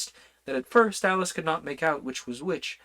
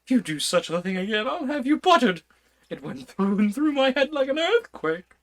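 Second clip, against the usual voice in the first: put-on higher, louder rattled old king; flustered King; 11 semitones higher, 5 dB louder than usual. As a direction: scold the messenger, still shaken. King scolds messenger.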